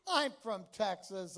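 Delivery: normal talking voice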